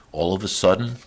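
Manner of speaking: ordinary delivery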